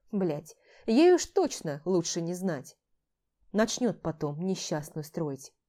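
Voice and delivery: ordinary delivery, nothing marked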